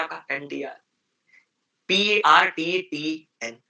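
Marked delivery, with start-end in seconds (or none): 1.9-3.6 slow spelling. Shown as a demonstration. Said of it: "spelling letters slowly".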